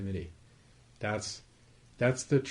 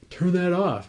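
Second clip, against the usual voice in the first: louder and higher, a sharp annoyed order to kill the noise; irritated snap; 7 semitones higher, 7 dB louder than usual. Retold or imitated. Someone fed up with the blaring smoke alarm, snapping angrily. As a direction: bark it at the alarm like it's ruining your day.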